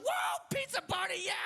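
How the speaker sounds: put-on very high-pitched shout of a kid crowd; imitated wild cheering. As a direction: cheer like a whole class going nuts. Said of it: "Whole class goes nuts".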